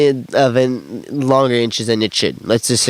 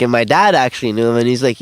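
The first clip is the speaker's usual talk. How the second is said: ordinary delivery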